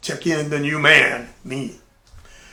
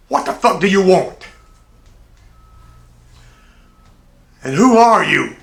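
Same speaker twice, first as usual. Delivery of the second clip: put-on louder, higher, barking the question at the new kid; gruff mock yell; 5 semitones higher, 10 dB louder than usual. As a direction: play the annoyed bully disturbed from his book. Tank snaps at the intruder.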